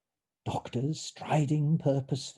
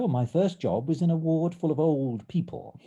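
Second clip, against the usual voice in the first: ordinary delivery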